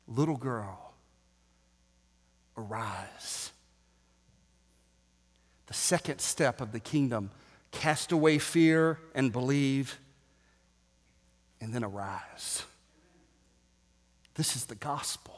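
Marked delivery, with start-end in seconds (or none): 0.1-3.5 put-on hushed and low, leaning down to a child; tender hushed call to the dead girl; soft whisper-like. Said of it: "I just gotta hope that he whispered". 14.3-15.3 breathy whispered aside; whispering the key line to the crowd. Real, not acted.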